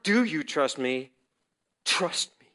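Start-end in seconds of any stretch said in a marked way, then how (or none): 1.8-2.5 hushed, firm whisper; whispered command. Retold or imitated. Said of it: "The command comes".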